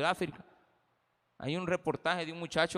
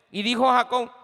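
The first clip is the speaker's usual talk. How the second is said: loud, high sermon delivery, slowing on the warnings; preacher driving a point home to the church; full-force preaching; 8 semitones higher, 10 dB louder than usual. Acted. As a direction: preach it out to the congregation.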